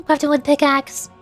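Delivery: usual speaking voice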